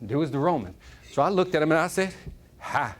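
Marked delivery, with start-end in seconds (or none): 2.6-2.9 low Puerto Rican try at Southern; Southern accent. Acted. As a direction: imitate a Southern greeting, low. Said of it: "when I talk Puerto Rican with this, with a Southern accent".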